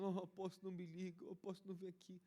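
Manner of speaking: high, loud, whiny mock lament; a hammed-up plea of crying followers; imitating weepy believers. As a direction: mimic the emotional ones begging the apostle. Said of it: "os que estavam na emoção".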